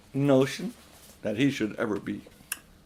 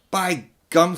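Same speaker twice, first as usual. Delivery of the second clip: louder, higher outburst; angry boy; 6 semitones higher, 6 dB louder than usual. Acted. Diggory furious at his uncle, angry. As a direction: burst out at him.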